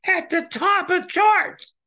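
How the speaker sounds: high excited shout, slowed down. Real, not acted. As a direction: belt it out in triumph. Excited claim about Dylan's chart.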